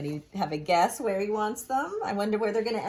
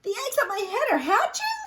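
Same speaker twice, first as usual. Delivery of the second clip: high, loud alarmed holler; hollering Gerald; 4 dB louder than usual. Acted. Gerald hollers at Piggy about the hatching eggs. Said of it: "Gerald hollers".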